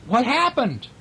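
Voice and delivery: high-pitched startled asking; alarmed question. A narrator's reading. Toy friends surrounding the little engine, alarmed high question. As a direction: ask high, full of concern.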